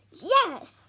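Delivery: high, loud, defiant yell; fierce yell. Acted. Tom yells defiance at his father. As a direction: shout it back fiercely. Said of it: "yells Tom fiercely".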